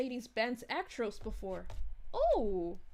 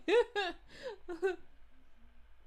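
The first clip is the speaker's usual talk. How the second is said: amused laugh; 5 semitones higher than usual. Real, not acted. Laughing at the Elsa joke.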